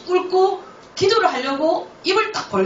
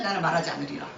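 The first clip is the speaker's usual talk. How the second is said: solemn vow; 6 semitones lower, 7 dB quieter, faster than usual. Retold or imitated. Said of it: "저는 끝까지".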